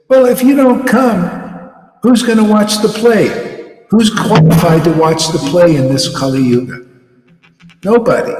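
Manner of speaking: high, emphatic imitation of the Lord; the Lord's retort. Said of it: "you know what the Lord said".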